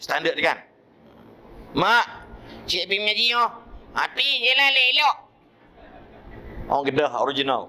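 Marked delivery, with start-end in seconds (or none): 1.8-3.5 put-on higher, eager little boy telling his gran; child's voice. Boy telling his grandmother he's going to Quran class, lightly childlike. 3.9-5.2 put-on much higher, louder old woman's answer; grandmother's reply. Grandmother telling the boy to take care, high old-woman voice.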